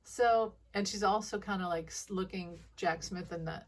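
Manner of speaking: ordinary delivery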